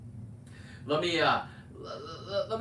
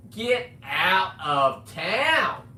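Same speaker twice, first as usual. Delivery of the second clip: scoffing shout; 5 semitones higher, 7 dB louder, much slower than usual. Real, not acted. Ranting at a rival diver.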